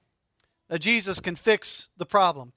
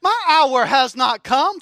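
sassy back talk; 4 semitones higher, 4 dB louder than usual. Pretend. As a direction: cry Woman up high, then drag out the rest. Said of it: "if you talk back to your mom".

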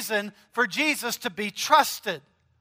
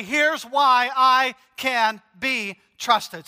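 slow, deliberate declaration; slow emphatic; 2 semitones higher, much slower than usual. Acted. Pastor voicing Jesus to listeners, slow and weighty. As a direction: lay each word down firmly.

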